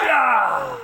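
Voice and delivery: loud playful yell; playful shout. Real, not acted. Dad bouncing his kid, a loud playful yell.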